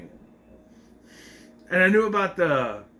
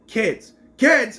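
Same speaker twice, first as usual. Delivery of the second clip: higher, louder correction as retold dialogue; insistent exclamation; 7 semitones higher, 7 dB louder than usual. She corrects him about who was kidnapped, insistent exclamation. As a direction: punch out the correction, voice jumping up.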